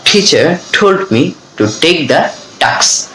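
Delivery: slow final model of the sentence; very slow model reading. Shown as a demonstration. Teacher gives a final slow model of the drill. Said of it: "এভাবে কিন্তু আমরা বলবো".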